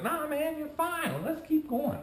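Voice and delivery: high-pitched, carefree buddy voice; retold breezy reassurance. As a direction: imitate a pal waving off worries. Buddy brushing off the worry.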